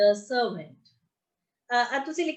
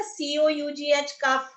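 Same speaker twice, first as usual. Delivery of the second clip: ordinary talk, nothing marked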